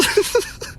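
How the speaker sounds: high breathy snicker; real giggle. Real, not acted. Laughing after own parody, high snicker. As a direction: let a short giggle escape.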